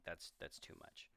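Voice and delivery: breathy whispered reaction. Retold or imitated. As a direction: whisper it in disbelief. Reacting to food scene.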